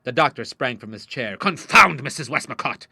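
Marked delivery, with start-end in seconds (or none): 1.4-2.9 loud, raised-pitch outburst; exasperated cry. A narrator's reading. Father springs from chair, crying out angrily. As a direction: explode on the first word. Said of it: "he cried, striking frenziedly into the air".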